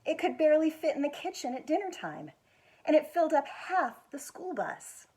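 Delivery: gentle, expressive picture book reading; reading to children. Acted. School foundation director reading a picture book to children, expressive storytelling. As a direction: tell it like bedtime story time.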